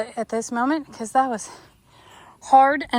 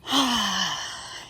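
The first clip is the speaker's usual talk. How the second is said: heavy sigh; 4 semitones lower, 4 dB quieter than usual. Real, not acted. Exasperated at finding a hole.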